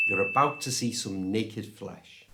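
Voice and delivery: ordinary talk, nothing marked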